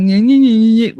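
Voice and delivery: loud nasal taunting nyeh; mocking whine. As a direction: mimic his complaining with scorn. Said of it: "you gotta bitch about it".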